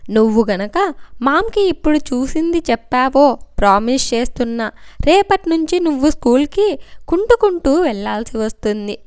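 ordinary delivery

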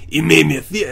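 usual speaking voice

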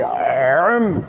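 আম with a squeezed throat, deep Arabic ain; আম said as عم, throaty ain, not a plain a; pressed throat sound. Shown as a demonstration. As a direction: tighten the throat and push আম out from deep down. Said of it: "কণ্ঠনালীটাকে একটু চাপ দিয়ে পড়ি".

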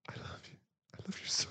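hushed, almost silent; whisper. Acted. To his partner in an embrace, whispering. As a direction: breathe it into her ear.

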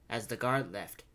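ordinary delivery, nothing marked

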